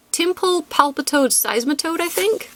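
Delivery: high questioning rise on think; unsure question. Real, not acted. Naming a Pokémon evolution line, unsure question. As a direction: ask it, doubting yourself.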